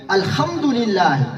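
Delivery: "الحمدللہ" drawn out slowly with kh for h; slow "الحمدللہ", its h turned into a throaty kh; Pashtun pronunciation. Retold or imitated. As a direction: imitate a Pashtun saying "الحمدللہ" as "al-khamdulillah". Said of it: "ہاں کو کیا پڑھتے ہیں".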